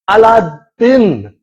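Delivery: Aladdin drawn out slowly as "ah-lah-din"; Aladdin, slow, open a vowels; slow careful Aladdin. Retold or imitated. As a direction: spell out Aladdin slowly, Arabic style. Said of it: "she said".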